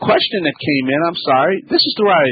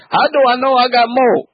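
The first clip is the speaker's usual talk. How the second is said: voicing the caller; 8 semitones higher, 3 dB louder than usual. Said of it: "I know it's a general question".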